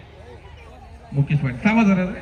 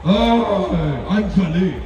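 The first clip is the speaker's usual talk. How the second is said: filmy hero line; much slower than usual. Shown as a demonstration. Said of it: "हा, मी बोलतोय".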